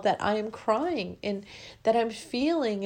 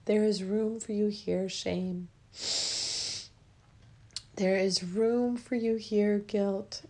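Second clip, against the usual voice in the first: guided affirmation; slower than usual. Acted. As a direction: let each word land gently, slowly. Leads a guided emotional release.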